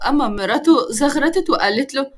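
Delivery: plain reading voice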